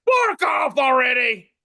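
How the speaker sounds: a high, slow, hammed-up angry shout from a mad cartoon fork. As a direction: snap it out like a furious little fork. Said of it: "the fork is very mad".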